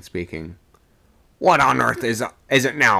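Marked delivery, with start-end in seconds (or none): 1.4-3.0 exasperated demand. A narrator's reading. Old man demands an explanation.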